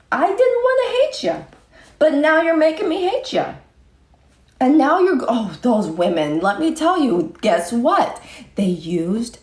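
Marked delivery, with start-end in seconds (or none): 0.1-3.5 high-pitched whiny repeat; high mock whine. Real, not acted. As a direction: squeak it up high. Repeated line, second higher.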